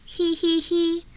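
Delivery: squeaky piggy giggle; high cheeky giggle. Acted. Storyteller ending the tale, with a high giggle.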